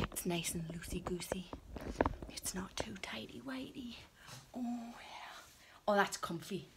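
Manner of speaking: soft, hushed whisper; contented hushed whisper. Real, not acted. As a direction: whisper it to yourself in delight. Trying on clothes, hushed delight.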